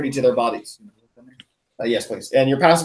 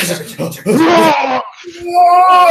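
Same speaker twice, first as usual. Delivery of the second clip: high, loud roaring war cry; wild battle scream mixed with laughter; 12 semitones higher, 6 dB louder than usual. Acted. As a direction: charge in howling. Zorak charges around the corner.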